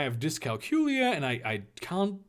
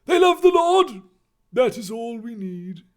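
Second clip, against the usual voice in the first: high, loud proclamation, easing off; emphatic Dumbledore; 10 semitones higher, 10 dB louder than usual. Acted. Dumbledore assuring Harry about faith, emphatically. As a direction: proclaim it, then settle.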